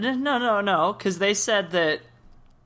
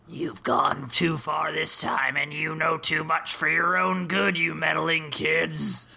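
villain voice. Acted. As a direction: growl it like a cornered villain. Villain unmasked threatens the kids.

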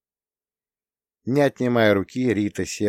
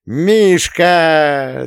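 high, louder cry of a name; a gleeful whoop of his name; wild yell; 9 semitones higher, 9 dB louder than usual. A narrator's reading. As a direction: sister whooping as she pounces on him. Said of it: "с диким воплем".

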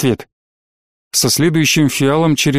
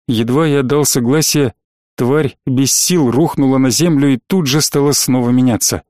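usual speaking voice

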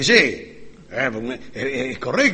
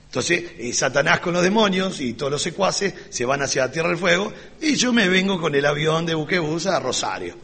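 ordinary talk, nothing marked

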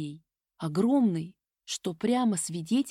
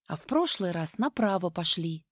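plain reading voice

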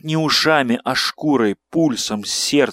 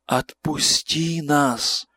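pleading ghostly crowd; 3 semitones higher, 3 dB quieter, much slower than usual. Acted. Kneeling crowd begs.

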